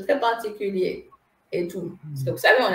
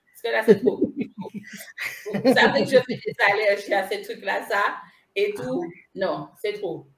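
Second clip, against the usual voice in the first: usual speaking voice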